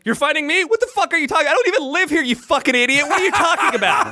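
high-pitched, incredulous angry comeback; indignant. Retold or imitated. Acting out his angry comeback.

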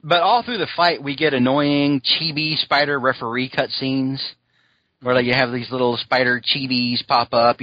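ordinary talk

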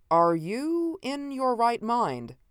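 very slow, high, drawn-out question. A narrator's reading. Host doubting the visitor's sanity, asking slowly. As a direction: stretch each word in disbelief. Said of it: "I asked slowly".

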